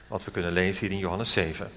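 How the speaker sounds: ordinary delivery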